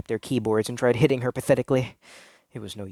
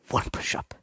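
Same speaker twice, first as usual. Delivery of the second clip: whispered deadpan; 6 dB quieter than usual. Acted. Abby's deadpan reply.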